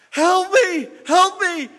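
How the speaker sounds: loud, high-pitched wail; desperate cry for help. Acted. Preacher acting a sufferer begging God, desperate cry. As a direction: cry out at the top of your voice.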